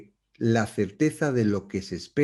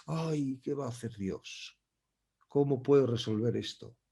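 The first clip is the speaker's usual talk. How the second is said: hushed, much softer fretful inner voice; Adam's worried thoughts; 8 dB quieter than usual. Acted. Preacher voicing Adam afraid of God, softly fretful. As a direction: murmur it like a scared man alone.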